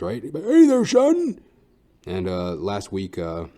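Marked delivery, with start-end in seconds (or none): 0.2-1.4 very high, loud old-man impression; a booming high-pitched old Colonel calling out; sobriety mentor's hearty greeting, pitched way up; the Colonel's voice. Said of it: "That's how he sounds".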